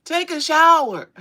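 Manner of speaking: high, loud, begging dad voice. Retold or imitated. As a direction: beg your daughter to wash, full volume. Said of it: "He said".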